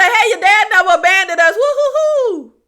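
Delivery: fast sing-song mock glee ending in a high whoop; sarcastically cheerful; sister's breezy confession, mocked in a high, gleeful voice.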